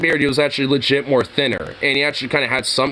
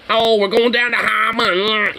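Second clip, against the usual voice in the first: nasal nerdy; 5 semitones higher than usual. Retold or imitated. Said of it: "too nasally and nerdy".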